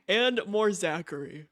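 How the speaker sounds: ordinary delivery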